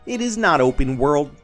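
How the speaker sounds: ordinary delivery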